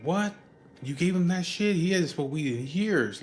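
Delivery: high-pitched, incredulous scolding; outraged wife. Retold or imitated. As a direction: imitate a wife telling off his friends. Wife scolds friends over blunt.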